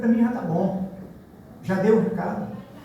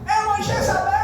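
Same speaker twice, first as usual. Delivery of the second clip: high, shocked cry of condemnation; mock-horrified church lady crying Jezebel; scandalized accusation. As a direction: squeal it like an outraged churchgoer. Accusers condemning girl's lipstick.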